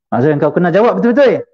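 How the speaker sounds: high, forceful retold order; imitating a harsh demand; hard, insistent demand. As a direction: lean on it like a stern questioner.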